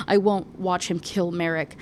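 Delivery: ordinary delivery, nothing marked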